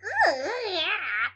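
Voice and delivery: high rising-falling noise effect; drawn-out squawky sound effect; imitated noise. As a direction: make a silly high wavering noise. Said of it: "It goes like".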